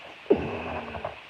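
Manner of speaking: a high, breathy giggle; high laugh. Real, not acted. Laughing at his own dog and wolf bit, high giggle. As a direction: crack up at your own joke.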